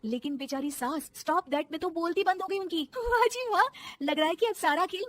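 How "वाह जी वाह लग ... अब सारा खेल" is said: high loud gloating; gloating cheer. Acted. Daughter-in-law gloats over frozen mother-in-law, triumphant. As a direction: crow with triumph.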